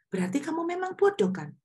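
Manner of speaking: quick, high-pitched rhetorical jab; pointed conclusion. Retold or imitated. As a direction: voice a knowing, teasing verdict. Voicing God's teasing conclusion.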